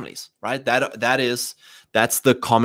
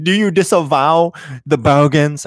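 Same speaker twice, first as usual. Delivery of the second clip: slow, louder, a hammed-up accusing inquisitor; mock leftist demand; 4 semitones higher, 5 dB louder, slower than usual. The left demanding that conservatives disavow the bogans, in a smug mock voice. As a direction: grill them like a smug interrogator. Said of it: "these guys on the left of us like".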